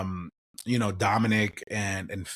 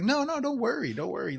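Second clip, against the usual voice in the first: mock reassurance; 13 semitones higher than usual. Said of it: "He's like".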